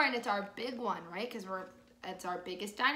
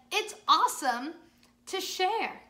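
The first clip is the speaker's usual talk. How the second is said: cheerful Joe voice; 6 semitones higher, 7 dB louder than usual. Acted. Joe's line in children's story.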